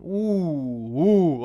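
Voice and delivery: two drawn-out oohs of shock; re-enacted wincing "ooh". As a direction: replay the wince as an ooh. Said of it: "And I went".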